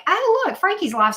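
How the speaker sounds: high, loud happy discovery; light up at the news; pleased surprise. Retold or imitated. Retelling spotting friend's stream, high pleased surprise. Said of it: "And I was like".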